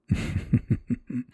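breathy, rippling little laugh; teasing chuckle. Acted. Lover teasing a partner, breathy chuckle.

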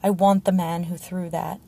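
plain reading voice

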